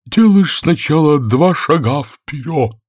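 high, slow, loud intoning; plaintive creature getting carried away; animated storybook turtle. A narrator's reading. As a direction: stretch out each dance step. Mock Turtle explains dance, animated.

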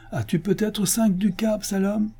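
very high, sly taunt; mocking question. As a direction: tease him with a high-pitched query. Chancellor taunts the petitioner. (question narquoise, très aiguë)

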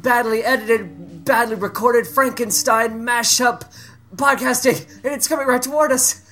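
high-pitched melodramatic alarm; mock horror. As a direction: warn them of a B-movie monster coming. Spotting monster in horror skit.

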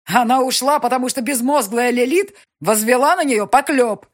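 гневно, намного громче и выше; сын кричит на мать в гневе; гневный крик. Acted. Nikolai furious at mother. (в ярости, во весь голос)

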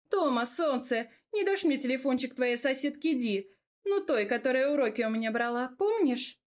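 sweet-talking guy, pitch lifted; chummy wheedling, ending on a high question; cheerful young man. Acted. Narrator voices visiting young man.

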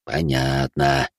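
a hint of a smiling old man. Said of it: "улыбается старик".

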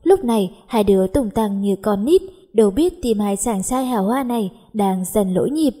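ordinary delivery

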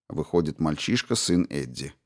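ordinary delivery

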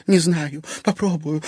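high, tearful moan that fades; sobbing moan. A narrator's reading. Wife answering Egor from the floor, sobbing moan. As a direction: moan it weakly through tears. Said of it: "рыдая простонала его жена".

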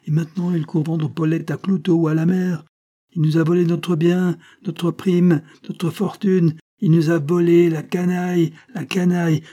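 put-on high, loud drunken rage; furious. A narrator's reading. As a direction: rant like a madman out for revenge. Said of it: "Furieux, excité par l'alcool".

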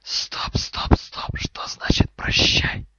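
alarmed, forceful stage whisper. Acted. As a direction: hiss it loud, slowing on the question. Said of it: "Голос змеи уже стал громче".

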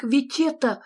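high-pitched nephew's exclamation; exclaiming. A narrator's reading. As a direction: exclaim in a small, high character voice. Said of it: "воскликнул его племянник".

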